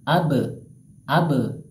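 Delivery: abe with the b bounced; bounced qalqalah. Shown as a demonstration. Teacher demonstrates bounced b to students. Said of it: "abe dipantulkan".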